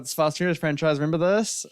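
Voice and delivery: hammed-up "remember this?" pitch; mock sales pitch. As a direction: act out a lazy franchise cashing in on nostalgia. Mocking a token-race movie's attitude.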